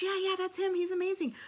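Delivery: very high, loud excited squeal. Retold or imitated. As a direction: gush it like a thrilled friend. Friend voiced confirming enthusiastically.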